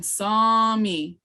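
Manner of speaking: "saw me" with a long, held "saw"; lengthened sound. Shown as a demonstration. Teacher giving an example of lengthened sounds, drawn-out vowel. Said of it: "on lengthened sounds".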